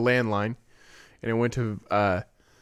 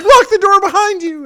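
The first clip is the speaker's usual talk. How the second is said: much higher, louder frightened plea; retelling his terrified mother at the door; panicked mom; 10 dB louder than usual. Retold or imitated. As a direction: imitate a scared mom begging urgently. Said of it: "She's like, she's like".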